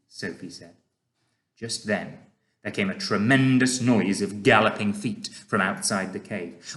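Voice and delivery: ordinary delivery, nothing marked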